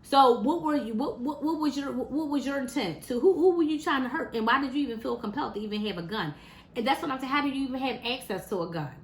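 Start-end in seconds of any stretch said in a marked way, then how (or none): none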